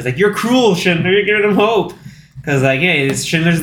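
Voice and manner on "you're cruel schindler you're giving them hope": high-pitched imitation of a reproach; mock chiding. Retold officer chiding Schindler about hope, high mock reproach. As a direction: chide him in a raised, playful voice.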